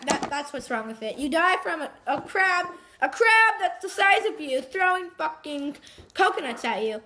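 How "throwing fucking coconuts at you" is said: normal talking voice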